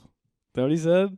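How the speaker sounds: high, loud question rising at end; surprised question. Real, not acted. Amazed friend asking what dad said, rising high. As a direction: ask it again, amazed.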